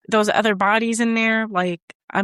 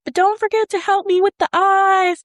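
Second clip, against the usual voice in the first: high-pitched mock child holler; kid yelling; 11 semitones higher than usual. Kid yells again to his dad, mock child holler. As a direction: imitate a boy calling down to dad. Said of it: "yells again".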